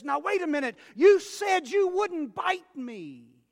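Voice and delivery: put-on dying boy crying out at the snake; betrayed outcry. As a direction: shout the betrayal as you die.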